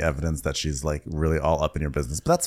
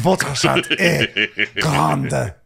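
slow, hammy French, pitched up; French accent; 6 semitones higher, 4 dB louder, much slower than usual. Pretend. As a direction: put on a mock French accent. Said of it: "French said as a bit".

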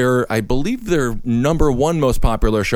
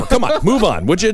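ordinary talk

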